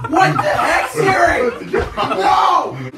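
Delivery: surprised laughing. Real, not acted. Loses the Siri pick.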